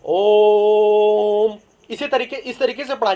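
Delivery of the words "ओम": ओम drawn out slowly and louder, ओ held long; prolonged Om. Shown as a demonstration. Teacher demonstrating ओम as a pluta vowel. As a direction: hold ओम three times as long. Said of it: "इसे पढ़ा जाएगा".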